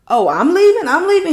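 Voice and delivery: loud, high indignant repeat; hammed-up incredulous shout; mock outraged shout. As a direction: throw it back at him, outraged. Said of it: "Danny was like".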